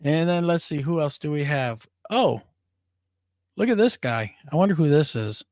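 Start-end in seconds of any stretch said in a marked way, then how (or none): none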